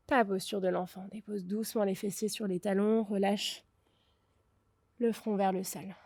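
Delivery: soft, calm closing-meditation voice; guided relaxation. Acted. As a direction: ease them into rest, quietly.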